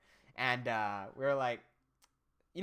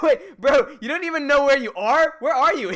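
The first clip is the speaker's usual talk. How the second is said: loud, higher disbelief at a friend; incredulous shout; 9 semitones higher, 14 dB louder than usual. Retold or imitated. Telling friend he doesn't know where he is, loud disbelief. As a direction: yell it like you can't believe him.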